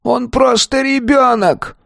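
high, slow anguished cry; desperate cry. A narrator's reading. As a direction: cry out to protect the child. Old man protests the verdict. (отчаянный крик)

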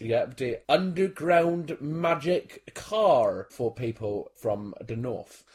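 slow, spaced-out mock explanation; mock explainer. Real, not acted. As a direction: spell it out slowly for the Northerners.

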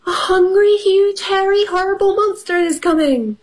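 high, panicked storybook fox; hammed-up high alarm; yelping Fox. A narrator's reading. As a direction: keep the pitch up as you cry the warning. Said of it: "yelped Fox".

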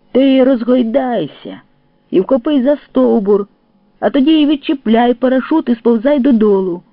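calling up instructions. A narrator's reading. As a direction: call your advice up to the treetop. Advising comrade stuck up a tree.

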